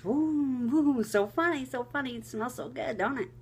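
high, teasing voice of the husband, wafting the covers; mock the husband gleefully playing with the smell. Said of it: "he's going like this with the covers".

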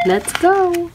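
plain reading voice

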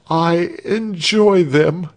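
high, drawn-out false delight; forced enthusiasm. A narrator's reading. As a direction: claim pleasure to please your torturer. Cowed victim feigns enjoyment.

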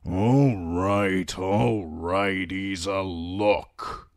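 grudging surrender in gruff character voice. A narrator's reading. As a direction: give in grumpily. Grumpy patient gives in.

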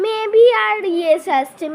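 slow, loud amazed call; excited. Real, not acted. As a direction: stretch it out in disbelief. Amazed at coin result.

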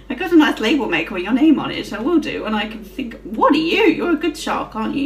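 normal talking voice

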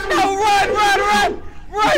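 high, frantic cries to flee; panicked urging. Real, not acted. Player telling teammates to flee, panicked urging. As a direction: urge them away in a panic.

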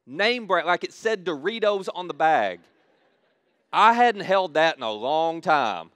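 loud, eager retelling of the thrill; excited. Retold or imitated. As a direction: relive the joy of finding name-brand chips. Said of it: "I was so excited".

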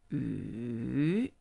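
Yu dipping low then rising; third tone yu. Shown as a demonstration. Teacher models the dipping third tone. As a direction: let Yu sink and then rise. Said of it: "falling, rising".

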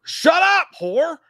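high, loud shout; mock yell. Real, not acted. Yelling at co-host over the list, a playful shout. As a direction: bark it at your buddy in jest.

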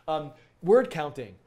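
slow and loud, stress "counting" not "word". Shown as a demonstration. Teacher stressing "counting" for viewers, loud and slow. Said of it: "Emphasis on the counting".

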